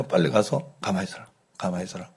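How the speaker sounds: put-on quiet firm command to a child; hushed stern order. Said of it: "이렇게 이야기하는데".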